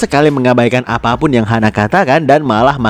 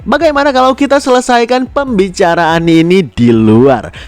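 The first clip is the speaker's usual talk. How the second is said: plain reading voice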